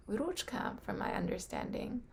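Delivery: ordinary talk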